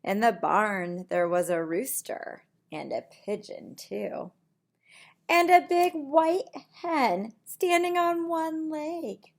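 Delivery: plain reading voice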